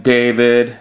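plain reading voice

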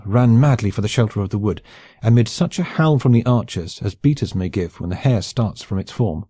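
ordinary delivery

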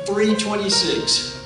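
ordinary delivery

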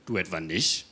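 understated low-pitched turning of the tables; deadpan comeback. Retold or imitated. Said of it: "Und ich sag".

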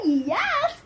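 quick high cheer; triumphant yes. Real, not acted. Shout of triumph.

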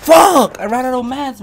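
raging at running out of mats; furious shout. Real, not acted. Streamer screaming at the game after dying.

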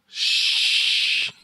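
long hissed shush; sharp, sustained "shh"; urgent shush. Acted. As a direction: hush him with a drawn-out shh. Villager hushing Anansi in the tale.

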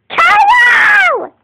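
very high, loud call; shout. Real, not acted. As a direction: yell his name for help. Calling brother in alarm.

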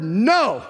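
"no" landed hard and drawn out; emphatic NO. Shown as a demonstration. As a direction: make "no" the loudest word. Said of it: "That's the loudest word in this sentence".

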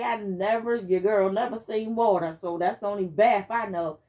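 ordinary delivery

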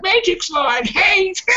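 high, slow whiny mimic of haters; mocking haters. Mocking online haters in a high whine. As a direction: sneer it in a dumb whine. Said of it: "idiots out there are like".

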